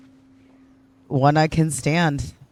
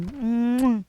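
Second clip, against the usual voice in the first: high "mwah" kiss sound; affectionate high-pitched mwah; blown kiss; 9 semitones higher than usual. Real, not acted. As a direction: send a smacking kiss to the viewer. Blowing viewer a kiss.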